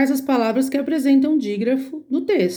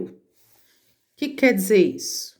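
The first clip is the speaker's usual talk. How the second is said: usual speaking voice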